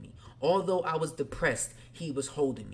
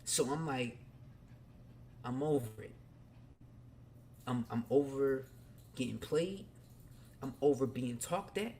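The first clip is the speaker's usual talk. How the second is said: ordinary talk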